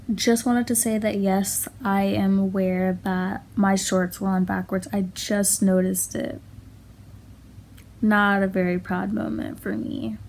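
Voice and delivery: soft, hushed voiceover; quiet. Real, not acted.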